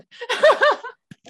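high bursting laugh; real laughter breaking out. Real, not acted. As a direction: laugh out loud, high and bright. Group laughing at accents.

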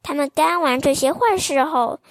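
ordinary delivery, nothing marked

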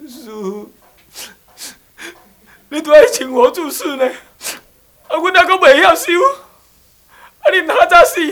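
hammed-up weeping female disciple; wailing nun. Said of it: "婆婆妈妈的话". (女众弟子夸张哭喊，高声尖叫)

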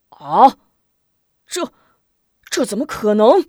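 a loud startled "啊" then stammering disbelief; shocked. Acted. The generals hear the bandits are inside, shocked disbelief. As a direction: gasp it out, can't believe it. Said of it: "惊得一扔酒杯".

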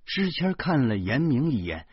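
plain reading voice

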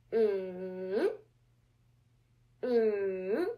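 "Mm" drawn out, low dip then slow rise; slowed third tone. Shown as a demonstration. Teacher slowing it for listeners, long dipping and rising hum. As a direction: stretch the hummed "Mm" down and up. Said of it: "make it slow for you to listen".

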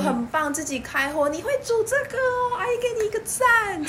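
praising auntie. Retold or imitated. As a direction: gush over them like a proud auntie. 模仿妈妈夸奖租客. (轻轻模仿阿姨夸奖)

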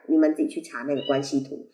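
ordinary talk, nothing marked

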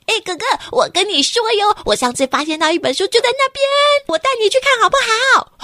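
high, loud eager chatter; excited kid. Acted. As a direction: burst out with excitement. Laixi excitedly chatters in library.

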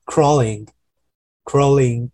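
CRAWLING very slowly, the L sounded; drawn-out L. Shown as a demonstration. Teacher showing the L sound at the back, slow crawling. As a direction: stretch out crawling to show the L inside. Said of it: "其实你有个了在后面".

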